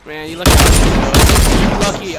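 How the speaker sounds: low, loud, slow taunt. Real, not acted. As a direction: bellow the threat slowly. Taunting opponent loudly.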